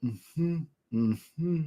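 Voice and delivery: ordinary talk, nothing marked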